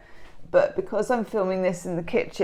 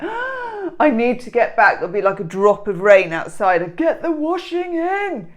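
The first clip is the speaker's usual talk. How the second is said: imitating mum; 4 semitones higher, 4 dB louder than usual. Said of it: "she'll be like".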